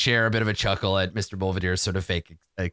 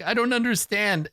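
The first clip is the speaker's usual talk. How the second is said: sniffling whimper; 11 semitones higher than usual. Acted. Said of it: "sniffles and he's like".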